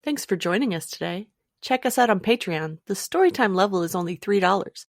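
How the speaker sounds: ordinary delivery, nothing marked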